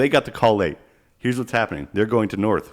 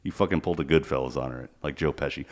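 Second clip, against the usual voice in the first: ordinary talk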